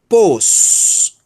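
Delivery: pos said high and loud; stressed syllable. Shown as a demonstration. Teacher stressing the tonic syllable pos for the class. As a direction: punch the syllable pos clearly. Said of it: "Sílaba tônica".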